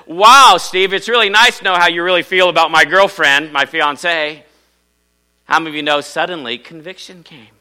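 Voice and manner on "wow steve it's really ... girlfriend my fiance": loud, sarcastic mock confrontation; the boyfriend calling Steve out, acted loud and indignant; indignant retold outburst. Boyfriend confronting Steve at the door, loud and indignant.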